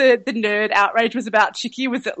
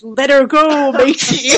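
pirate voice; 5 semitones higher, 5 dB louder, slower than usual. Retold or imitated. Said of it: "with that pirate voice".

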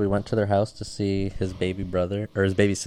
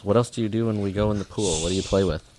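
ordinary delivery

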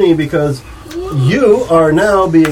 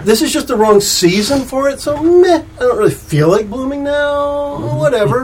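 higher, lazy and uncaring; bored indifferent voice; 7 semitones higher than usual. Pretend. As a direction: sigh it like nothing matters. Voicing the indifferent flower.

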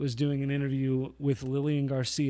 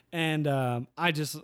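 ordinary delivery

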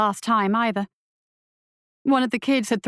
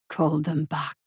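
put-on low, softer, heavily accented command; 5 semitones lower, 4 dB quieter, slower than usual. A narrator's reading. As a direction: command it slowly from the dark. Creature voice continues.

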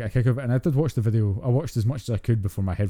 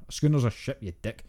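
ordinary talk

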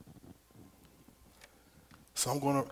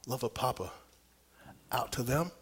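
ordinary delivery

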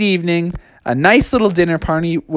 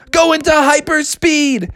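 yelled; 11 semitones higher than usual. Acted. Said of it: "Sonic yelled out".

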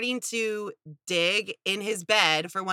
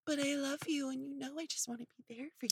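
much softer, smug sweet-talk; smirky fake-sweet boyfriend; 5 semitones higher, 13 dB quieter, faster than usual. Mocking a manipulative boyfriend's soft smirking promise. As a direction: coo it with a smirk, faking care. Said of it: "give her a smirk and be like".

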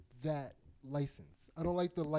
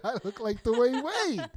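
higher, slow mocking echo; mocking quote; 6 semitones higher, 5 dB louder than usual. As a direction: throw his words back, teasing. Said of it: "You just said".

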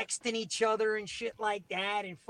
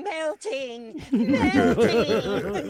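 high mock wail, growing louder; melting witch; 3 semitones higher, 6 dB louder, much slower than usual. Old lady disgusted by the devilish voice, mock wailing. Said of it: "she's ready to start saying".

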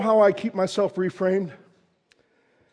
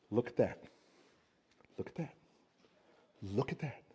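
hushed wonder; 7 semitones lower, 16 dB quieter than usual. Retold or imitated. Hushed pointing at fields.

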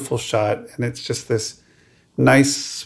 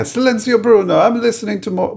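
high, hammed-up Italian accent; bad Italian accent; 11 semitones higher than usual. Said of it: "Like, bad, bad Italian".

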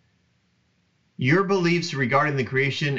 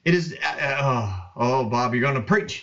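ordinary talk

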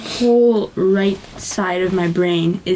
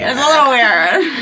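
put-on valley girl voice; 5 semitones higher, 4 dB louder than usual. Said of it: "That's what I sounded like in high school?".

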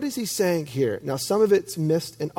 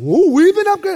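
high, loud imitation of thrilled kids; mock-excited shout, pitched way up; 12 semitones higher, 7 dB louder than usual. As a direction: squeal it like overjoyed children. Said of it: "To which the kids are like".

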